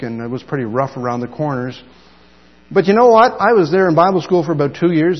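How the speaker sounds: usual speaking voice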